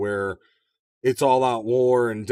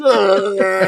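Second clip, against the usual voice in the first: loud, higher mock battle scream; 6 semitones higher, 8 dB louder than usual. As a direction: parody action-hero roar while blowing things up. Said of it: "[name] did the Rambo skit".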